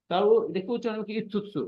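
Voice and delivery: ordinary talk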